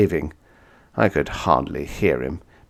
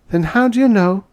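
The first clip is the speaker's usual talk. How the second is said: much higher, doubting woman's voice; sceptical woman; 14 semitones higher, 5 dB louder than usual. Acted. Woman doubts he knows where the man is, higher and sceptical. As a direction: press him with disbelief.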